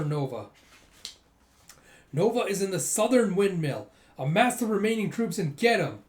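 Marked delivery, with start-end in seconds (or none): 2.1-6.0 higher, louder commanding call; barked orders. Acted. Commander sending troops after Nova, loud orders.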